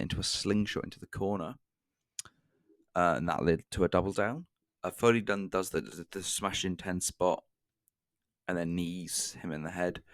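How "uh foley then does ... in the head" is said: ordinary delivery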